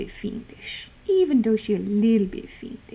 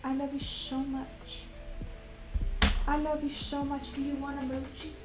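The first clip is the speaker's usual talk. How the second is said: soft, higher cooing to the dog; 4 semitones higher, 8 dB quieter than usual. Real, not acted. Mother cooing softly at her little dog in baby talk. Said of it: "I'm in baby talk mode".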